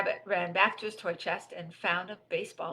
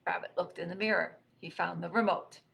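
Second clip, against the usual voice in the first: usual speaking voice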